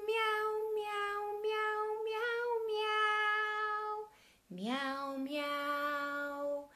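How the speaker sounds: high sung meowing; cat meows. Pretend. Teacher meows like a cat for toddlers. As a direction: meow like a cat in the song. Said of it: "And he says".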